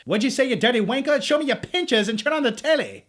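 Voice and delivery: mock a rowdy Brit, clearly put-on; crappy British accent. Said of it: "That's my crappy British accent".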